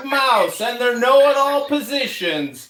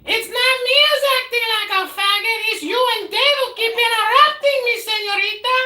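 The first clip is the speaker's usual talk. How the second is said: shouting match; 12 semitones higher than usual. Real, not acted. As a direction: shout over the heckler. Yelling back in argument.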